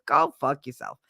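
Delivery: normal talking voice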